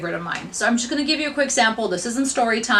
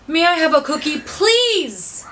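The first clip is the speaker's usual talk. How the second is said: "I" pushed high and loud, "please" higher still and falling; stress on I, louder; 6 semitones higher, 4 dB louder, slower than usual. Shown as a demonstration. Teacher giving the last, loudest take, pressing on "I". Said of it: "depending on where you put the vocal inflection".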